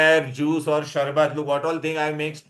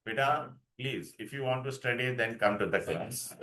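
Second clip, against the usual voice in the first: quiet warning; 3 semitones lower, 10 dB quieter than usual. Real, not acted. Teacher warning student quietly.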